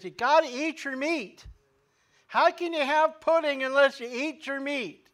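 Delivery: high, loud mock teacher voice, barking; mean old teacher. Preacher imitating the Pink Floyd teacher for his congregation, loud and harsh. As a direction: do the nasty schoolmaster from the song, loud and harsh. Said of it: "mean old nasty teacher. Got this really rough, hoarse voice".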